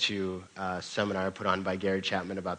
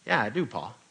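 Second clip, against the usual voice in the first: lightly voiced cowboy, a little higher; a hint of a laconic cowboy answering; 4 semitones higher, 4 dB louder than usual. Son Buck answering his father, lightly voiced cowboy. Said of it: "cowboy voice".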